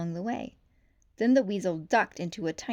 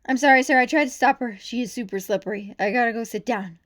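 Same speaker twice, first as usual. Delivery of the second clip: panting; 3 semitones higher, 8 dB louder than usual. A narrator's reading.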